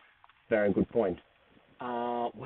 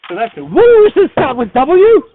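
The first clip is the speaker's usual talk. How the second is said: excited shout; 10 semitones higher, 13 dB louder than usual. Real, not acted. Excited realization about W.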